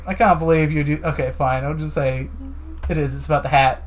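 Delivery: ordinary talk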